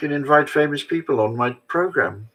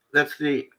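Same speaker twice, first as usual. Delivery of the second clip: normal talking voice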